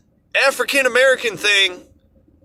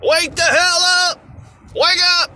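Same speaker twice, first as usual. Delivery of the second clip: pitched-up rallying yell; mock scream at everybody; 9 semitones higher than usual. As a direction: shout it down from a hilltop at the whole country. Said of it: "scream at everybody".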